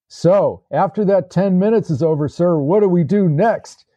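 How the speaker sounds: lightly voiced eager questioner; young soldier's question. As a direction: put the question up to a superior, pitched high. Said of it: "one of the young men asked".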